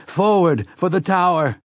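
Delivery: high charging cry; battle cry. Acted. Roland leads the charge with a high cry. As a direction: urge them forward, pitched high. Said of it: "cries Roland of Gilead".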